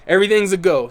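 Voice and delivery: ordinary talk, nothing marked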